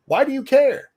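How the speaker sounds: high, loud challenge; exasperated retort. Acted. Host answering people who question where profits go, exasperated. Acted retort to nosy donors.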